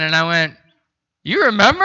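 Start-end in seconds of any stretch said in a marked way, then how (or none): 1.2-1.8 surprised. Retold or imitated. Surprised the clerk remembers him.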